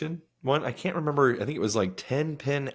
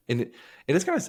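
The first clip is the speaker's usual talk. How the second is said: ordinary talk, nothing marked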